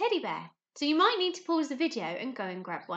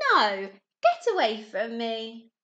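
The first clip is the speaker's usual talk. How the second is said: loud, slow, scornful push-off; rude refusal; 8 dB louder, slower than usual. Acted. Rainbow fish driving the little blue fish off, loud rude refusal. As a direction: send him away.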